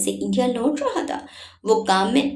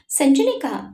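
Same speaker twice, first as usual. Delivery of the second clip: ordinary delivery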